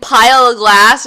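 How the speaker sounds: normal talking voice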